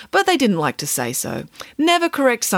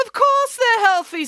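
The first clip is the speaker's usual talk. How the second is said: high, slow, insistent nanny voice; emphatic nanny; 3 dB louder, slower than usual. Acted. Nanny assuring the children donuts are healthy, emphatic. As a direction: protest in a much higher pitch, drawing it out.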